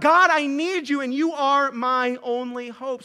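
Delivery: loud, high plea that slows at the end; desperate cry to God. Acted. Jonah crying to God in distress, loud and pleading. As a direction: cry out as if drowning.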